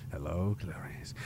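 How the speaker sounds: a bad creepy Lecter hush, drawn out; bad Hannibal Lecter. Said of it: "doing a bad, you know, Doctor Lecter".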